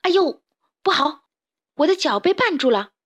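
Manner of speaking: startled and panicky; alarmed yelp. Acted. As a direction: blurt out in sudden trouble. Character suddenly caught in a trap.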